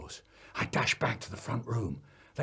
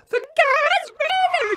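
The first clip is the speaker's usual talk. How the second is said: put-on long, very high child's scream; 10 dB louder, slower than usual. A narrator's reading. Three-year-old in nosediving car, screaming out the window. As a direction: shriek it all the way down. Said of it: "Eddie screaming out the window".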